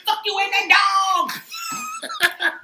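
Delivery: high, laughing mock voice; voicing the guy. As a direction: repeat it laughing. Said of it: "That's what he was saying".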